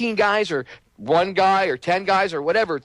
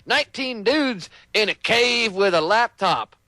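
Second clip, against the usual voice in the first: high, silly mock-innocent voice; mocking gullible citizen; 4 semitones higher than usual. Mocking the people who buy the story, high and silly. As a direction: play dumb in a high sing-song. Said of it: "I could easily walking around going".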